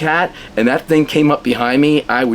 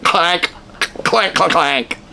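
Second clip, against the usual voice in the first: higher clank, clank said like metal knocking; imitated clanking; 6 semitones higher, slower than usual. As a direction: mimic a stuffed cat banging on glass. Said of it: "it's making a clanking noise".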